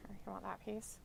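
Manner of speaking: whispering to the cat while offering food. Real, not acted.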